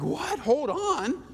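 high, mock-panicked disbelief; incredulous Noah. Noah protesting to God, high mock disbelief. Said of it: "You're like".

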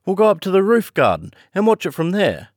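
high, keen and breathless with thrill; excited invitation to watch; eager girl. A narrator's reading. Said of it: "she cried to him".

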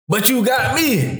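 high, slow incredulous protest; mock indignant, high and drawn out; retold protest. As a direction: pitch it way up in disbelief.

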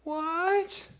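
squeaky, sky-high disbelieving what; shocked what. Acted. Preacher mocking his own shock to the congregation, a stunned squeak. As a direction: feign total shock at the news. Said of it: "mock disbelief at wife's apology".

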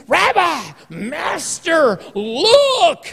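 high, excited call to the master; excited Peter. Retold or imitated.

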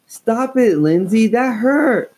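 high, loud hurt shout; angry yell. A narrator's reading. Slapped girl yelling at her sister, angry and loud.

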